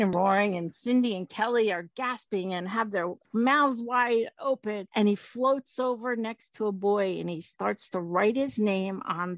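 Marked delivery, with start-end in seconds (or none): none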